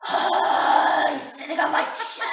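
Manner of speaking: loud, breath-heavy gasped imitation; hammed-up huffing psychic; huffing ammonia. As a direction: mimic a huge huff, then force the words out. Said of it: "She huffs this ammonia and then goes".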